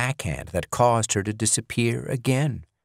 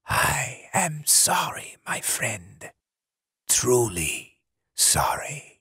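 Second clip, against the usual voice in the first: slow unvoiced whisper; 3 semitones higher, 4 dB quieter, slower than usual. Ritter whispering his apology to the dying Pauline. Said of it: "and whispered".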